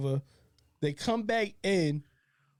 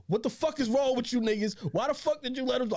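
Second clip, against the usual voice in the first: higher, fast mock outburst at the friends; retold wilding out; 8 semitones higher than usual. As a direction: replay the angry scolding, pitch up. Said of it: "I'm wilding in the crib".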